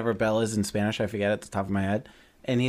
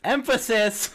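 high, loud exclamation with raised eyebrows; emphatic shout; 12 semitones higher, 4 dB louder, slower than usual. Pretend. Mocking the eyebrow remark, high emphatic shout. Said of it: "lift your eyebrows for emphasis".

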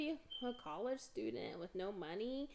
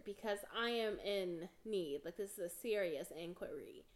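normal talking voice

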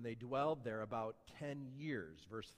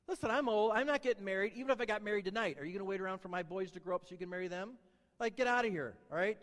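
higher, louder, fast sarcastic paraphrase; 4 semitones higher, 4 dB louder, faster than usual. Retold or imitated. Preacher paraphrasing Naomi to the church, sarcastic and fast. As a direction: rattle it off like a bitter old woman. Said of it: "Is she getting a little sarcastic".

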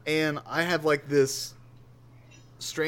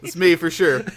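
real laughter breaking through the words; loud, high, laughing admission; 7 semitones higher, 10 dB louder than usual. Real, not acted. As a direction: own up to it while cracking up. Laughing at own resting face.

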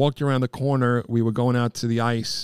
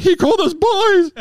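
much higher, louder, thrilled; giddy fan; 7 dB louder than usual. Retold or imitated. Roach retelling his thrill, high giddy squeal. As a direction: squeal it like a starstruck kid. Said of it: "I was like".

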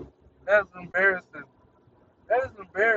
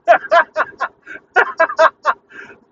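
squealing high laugh that breaks loose; cracking up at his own sweet ass joke, high squealing laugh; high burst of laughter; 11 dB louder than usual. Real, not acted. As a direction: let a helpless high cackle out.

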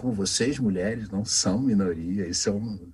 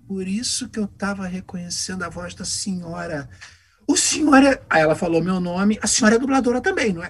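excited recognition; 9 semitones higher, 5 dB louder than usual. Retold or imitated. Shop assistant recognizes the voices.

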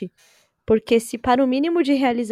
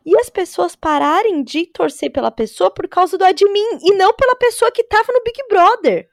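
excited; 8 semitones higher, 6 dB louder than usual. Real, not acted. Outraged rant about reality show fans.

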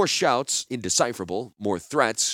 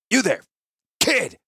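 loud, high call across the market; 12 semitones higher, 5 dB louder than usual. Acted. Gerard calls loudly to a lad across the market. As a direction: hail the boy from afar. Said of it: "Gerard called".

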